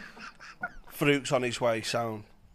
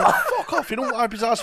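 high, loud mock disbelief; laughing, incredulous outburst; 12 semitones higher, 9 dB louder than usual. Comic acts out reaction to the gossip, loud mock disbelief.

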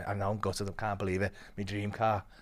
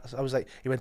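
usual speaking voice

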